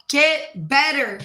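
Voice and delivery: slow, loud, high order; harsh command. Acted. Abusive leader ordering the team, harsh command. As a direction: bear down on each word.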